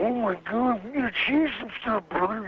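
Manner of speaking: a hint of the Macho Man in a panic; Randy Savage. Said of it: "Randy goes".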